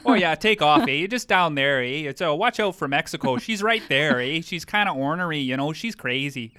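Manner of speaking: hammed-up Canadian accent. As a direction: friend teasing in exaggerated Canadian accent. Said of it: "You do that too well".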